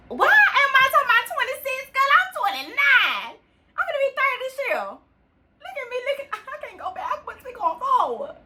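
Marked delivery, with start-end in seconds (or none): none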